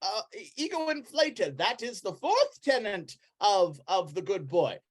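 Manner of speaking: high, emphatic preacher; admonishing Brother Flufferkin. Acted. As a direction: lecture a convert on the tenets. Voices cult preacher admonishing.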